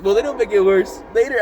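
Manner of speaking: ordinary delivery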